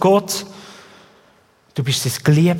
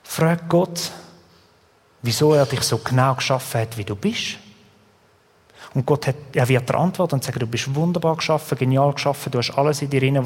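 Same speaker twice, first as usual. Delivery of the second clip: soft reassurance; 5 semitones lower, 4 dB quieter than usual. Acted. Soft voiced God's assurance.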